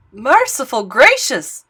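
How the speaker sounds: loud, slow startled exclamation; old bell ringer shocked by oobleck; shocked gulp. Acted. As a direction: gasp it out in shock. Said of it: "he gulped".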